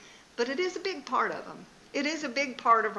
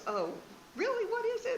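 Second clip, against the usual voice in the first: much higher, lightly voiced excited husband; eager husband; 13 semitones higher, 4 dB quieter, faster than usual. Wife imitating her husband's delighted surprise. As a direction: play him perking up like a kid.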